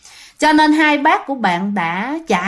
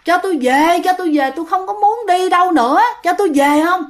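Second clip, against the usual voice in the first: high-pitched insistent protest; retold yelling; 6 semitones higher than usual. Daughter in car demands to go home, acted yell. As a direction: act out a frantic demand to go home. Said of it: "chị H la lên quá trời".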